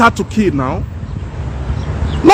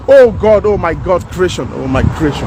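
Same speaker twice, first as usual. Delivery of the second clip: anguished lament; 6 semitones lower than usual. Real, not acted. Lamenting suffering.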